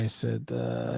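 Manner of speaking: usual speaking voice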